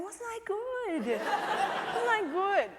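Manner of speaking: high, slow, drawn-out singsong; self-pleased child. Retold or imitated. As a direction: imitate a little boy fishing for praise. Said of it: "walking around at lunchtime going".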